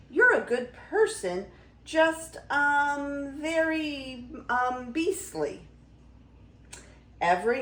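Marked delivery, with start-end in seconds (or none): 0.1-5.7 high, halting, searching for kind words; awkward hesitation. A narrator's reading. Beauty softens her refusal to the beast, awkwardly. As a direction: hold the ums while hunting for a polite word.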